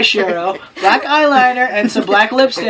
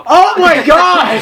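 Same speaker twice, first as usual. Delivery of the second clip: laughing shout; 7 semitones higher, 6 dB louder, slower than usual. Real, not acted. Reacting to the fanfic, laughing.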